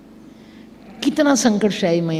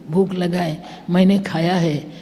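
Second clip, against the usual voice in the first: ordinary talk, nothing marked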